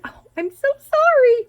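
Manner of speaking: mocking an insincere apology; mock fake apology. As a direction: sneer a fake sorry in a silly high voice.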